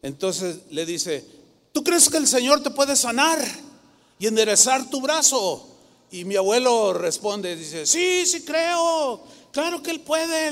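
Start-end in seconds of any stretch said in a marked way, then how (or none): 1.7-5.7 raised, higher preaching voice; imitated faith challenge. Preacher woman asks grandfather before the meeting, imitated forceful challenge. 7.8-10.5 high-pitched, drawn-out grandfather's yes; imitated eager faith. Grandfather answers the preacher woman, imitated high, eager belief.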